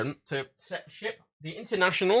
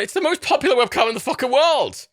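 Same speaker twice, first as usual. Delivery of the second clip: higher, louder exasperated exclamation; exasperated outburst; 9 semitones higher, 5 dB louder than usual. Real, not acted. Vlogger ranting that the popular webcam fails, exasperated. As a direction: vent at the absurdity. Frustrated rant about webcam.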